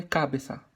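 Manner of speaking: "cabeça" with a loud first syllable, CÁ-be-ça; stress on the wrong syllable. Shown as a demonstration. Teacher showing what it isn't, first syllable of "cabeça" stressed. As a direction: punch CA at the start of "cabeça". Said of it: "Não é cabeça".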